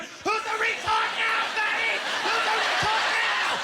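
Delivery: high, slow, loud mock gloating; taunting shout. Comedian gloating over an imagined opponent, taunting shout. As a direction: holler it out as a drawn-out taunt.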